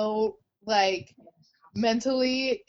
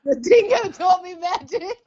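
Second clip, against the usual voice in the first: crying with laughter through the words; laughing; 5 semitones higher, 5 dB louder than usual. Real, not acted. Friend repeats the joke while cracking up. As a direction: gasp it out mid-laugh.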